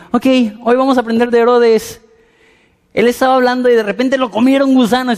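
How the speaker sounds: chirpy teacher breaking bad news; Sunday school teacher. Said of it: "Es como que". (maestra de escuela dominical, alegre y aguda)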